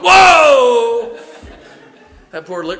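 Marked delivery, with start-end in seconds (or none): 0.0-1.2 loud, high startled yell of fright; startled shout. Retold or imitated. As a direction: jump out of your skin and yell. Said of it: "and I'm going".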